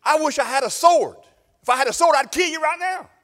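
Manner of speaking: high, loud, furious threat; furious prophet. Acted. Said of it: "and then Balaam says".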